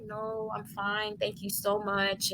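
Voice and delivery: hushed, slow thanks; soft polite refusal. Retold or imitated. Mother declines driver's offer of a room, soft and grateful. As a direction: gently turn down a kind offer.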